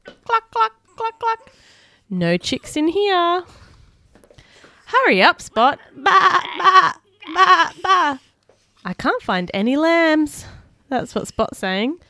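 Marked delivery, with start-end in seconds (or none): 0.0-10.4 lively, higher read-aloud voice; animated picture-book reading; reading to children. Acted. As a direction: read it like bedtime for little ones.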